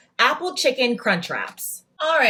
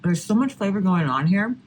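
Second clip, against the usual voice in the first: ordinary delivery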